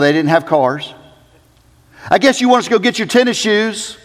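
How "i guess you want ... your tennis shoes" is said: quick, high, eager disciple voice. Retold or imitated. Disciples eager to rush off, fast and high. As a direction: rush it out like keen followers.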